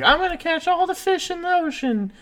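put-on a high, boyish brag; little boy voice. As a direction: chirp it like an eager kid.

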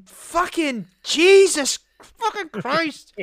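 very high, very slow strained cry of despair; stuck behind a car, a drawn-out anguished curse; exasperated outburst. Retold or imitated. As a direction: wail it out in disbelief at the traffic. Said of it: "And you're just like".

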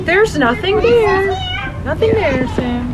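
put-on high, slow soothing to a little boy; calm him down gently; reassuring child. Mother soothing her scared son Sam, high and slow. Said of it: "and I'm like".

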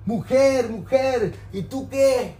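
loud pleading sermon; fiery preaching. Real, not acted. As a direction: call them out from the pulpit. Preaching at full force.

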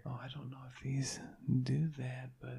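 soft ASMR whisper. Pretend. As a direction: whisper into the mic.

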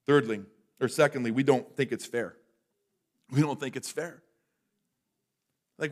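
ordinary talk, nothing marked

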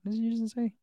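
mock bafflement, voice lifted; puzzled reaction. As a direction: react as if you misheard. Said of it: "every time I hear it, I'm like".

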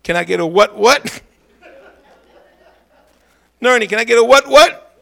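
preacher's call-and-response, building up. Real, not acted. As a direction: pull a response out of the room, climbing. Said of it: "at least give me a what".

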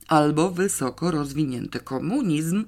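plain reading voice

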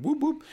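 playful high siren whoop; siren imitation. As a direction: whoop whoop sung very high like a squad car.

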